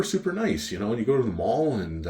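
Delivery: normal talking voice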